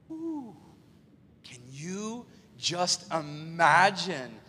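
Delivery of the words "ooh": ordinary talk, nothing marked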